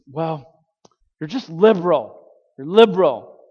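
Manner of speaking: retold scolding, landing hard on the accusation; mock motherly reproach. Mother accusing her son of being too liberal, mock exasperated reproach.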